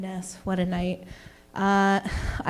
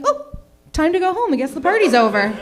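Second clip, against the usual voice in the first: guests leaving; 8 semitones higher, 5 dB louder than usual. Retold or imitated. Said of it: "everyone's like".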